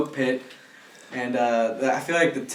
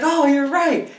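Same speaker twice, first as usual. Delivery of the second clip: pitched higher, amused agreement; guy agreeing; 12 semitones higher, 4 dB louder, slower than usual. Retold or imitated. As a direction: agree with her, voice up high. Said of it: "And he's like".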